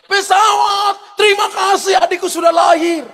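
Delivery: high, long-held holler skyward; re-enacted child's calling-out; shouting to a plane. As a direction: yell it up to the passing plane. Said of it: "kita berteriak".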